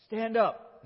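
loud call. Acted. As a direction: call it out to the lame man as loud as you dare. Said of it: "as loud as he can".

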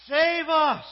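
very loud, high-pitched shout; belted cry, falling at the end; shouting together. Shown as a demonstration. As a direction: lead the congregation in a full-out shout. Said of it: "let's shout together".

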